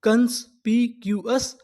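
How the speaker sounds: कंस्पिक्यूअस stretched out slowly; slow full word. Shown as a demonstration. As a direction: model कंस्पिक्यूअस slowly for the class. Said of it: "तो पूरी meaning". (धीरे-धीरे उच्चारण)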